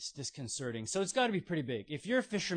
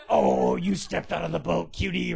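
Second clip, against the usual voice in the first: loud affectionate teasing; rough him up fondly with your voice; playful teasing; 2 semitones higher, 10 dB louder than usual. Retold or imitated. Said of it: "giving Peter a noogie".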